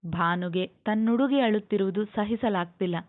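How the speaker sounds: usual speaking voice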